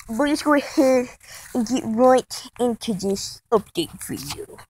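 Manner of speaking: normal talking voice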